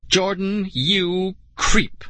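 high, indignant accusation; cross girl telling someone off; angry. A narrator's reading. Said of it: "Nicole declared angrily".

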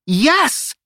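high, loud triumphant cheer; excited shout. A narrator's reading. Nia hearing her home city picked, shouted cheer. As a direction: punch it out in triumph. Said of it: "Nia shouted".

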